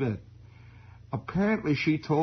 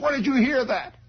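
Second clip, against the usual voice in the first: high, louder demanding mother; stern mother; 13 semitones higher, 4 dB louder than usual. Retold or imitated. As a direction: demand it right now.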